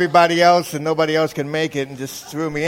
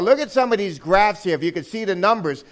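usual speaking voice

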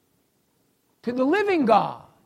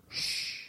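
soft unvoiced shh; shushing; 16 dB quieter than usual. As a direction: hush the room. Preacher hushes congregation to hear.

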